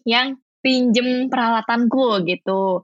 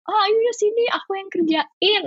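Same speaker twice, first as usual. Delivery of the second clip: higher-pitched quoted offer; eager volunteering; 9 semitones higher than usual. Retold or imitated. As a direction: jump in offering help, voice lifted. Retold eager offer to do work.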